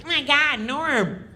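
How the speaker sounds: high, whiny nitpicking voice; mock Kattan, pitched high and pestering; Kattan nagging. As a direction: needle him in a high nagging whine. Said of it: "he started busting on Norm".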